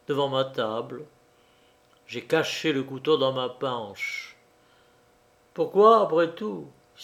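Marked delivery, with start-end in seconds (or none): none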